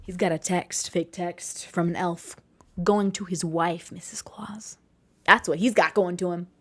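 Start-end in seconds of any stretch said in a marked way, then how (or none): 3.9-4.8 hushed whispered punchline; sly whispered reveal. Pretend. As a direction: drop it to a secret whisper. Punchline of fake text joke.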